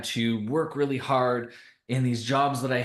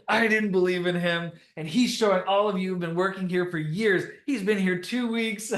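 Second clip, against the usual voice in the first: imitating boss, laughing; 7 semitones higher than usual. Boss praises new hire before veteran staff, laughing mock voice. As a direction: keep the mock boss voice while cracking up. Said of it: "and he's like".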